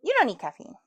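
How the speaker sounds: normal talking voice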